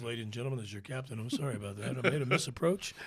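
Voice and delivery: low, subdued captain on the intercom; airline captain announcement. Retold or imitated. Captain apologizes to passengers after missed approach, low and matter-of-fact. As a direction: play a calm pilot addressing the cabin. Acting the pilot's cabin announcement.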